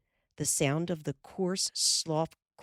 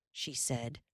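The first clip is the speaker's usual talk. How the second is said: ordinary delivery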